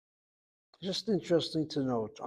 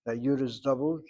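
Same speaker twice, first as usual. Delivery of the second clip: usual speaking voice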